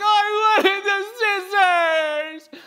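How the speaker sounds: put-on high, slow whimpering kid voice; mock whining child. Son whines when dad takes scissors, mock crying. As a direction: whine like a crushed little boy. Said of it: "He's like".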